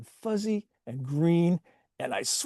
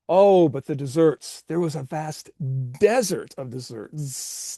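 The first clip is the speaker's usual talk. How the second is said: usual speaking voice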